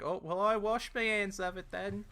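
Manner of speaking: high-pitched Cockney woman impression; huffy Cockney servant, hammed up; mock Cockney lady. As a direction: put on a fun, high Cockney voice. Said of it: "it's just a fun voice to do".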